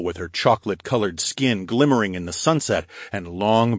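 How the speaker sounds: ordinary delivery, nothing marked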